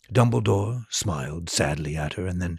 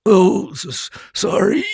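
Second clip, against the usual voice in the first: higher stammering sob; sobbing; 12 semitones higher, 7 dB louder than usual. A narrator's reading. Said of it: "sobbed Hagrid".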